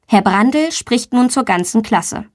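ordinary delivery

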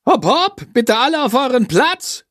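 high, hurried teacher's urging; pushy teacher. A narrator's reading. Teacher hurrying the class onto their places, high and pushy. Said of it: "drängelt Frau Schmidt und klatscht in die Hände".

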